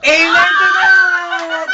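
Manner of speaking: loud, drawn-out cheer; excited shout. Real, not acted. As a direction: shout it like a triumph. Cheering after eating balut.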